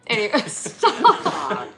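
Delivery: slow words through laughter; laughing. Real, not acted. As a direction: try to stop laughing. Laughing fit.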